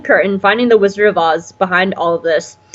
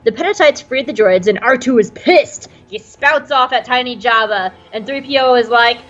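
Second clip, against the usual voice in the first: ordinary delivery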